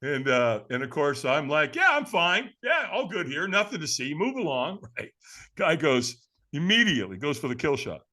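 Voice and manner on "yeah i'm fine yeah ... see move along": high, too-quick mock reassurance; falsely breezy, pitched up; feigned nonchalance. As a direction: brush off the stranger, badly faking calm. Pretends to be fine to stranger.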